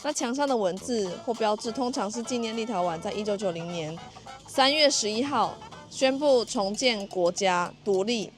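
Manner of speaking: ordinary talk, nothing marked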